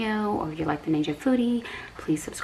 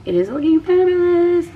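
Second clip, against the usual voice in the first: high and loud with delight; excited; 13 semitones higher, 8 dB louder than usual. Real, not acted. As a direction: gush with excitement. Excited over melting cheese.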